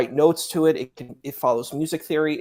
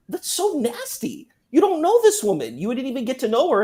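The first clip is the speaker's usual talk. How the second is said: disgusted; 10 semitones higher, 3 dB louder than usual. Real, not acted. Disgust at men chasing convert.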